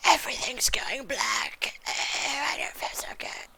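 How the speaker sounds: slow, soft whisper passing out; fading whisper. Acted. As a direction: drift off weakly.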